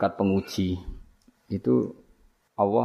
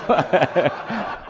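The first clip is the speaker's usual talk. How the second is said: a high burst of laughter rising; 8 semitones higher than usual. Real, not acted. Preacher laughs at the teacher's claim, high bursting laugh. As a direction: laugh out at the joke. Laughing at his own joke.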